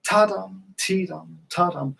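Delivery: Ta-dum with a punch on ta; sung rhythm demo, accent on the snap; stress the short note. Shown as a demonstration. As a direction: hit the first short syllable of each ta-dum. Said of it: "put the emphasis on the semiquaver".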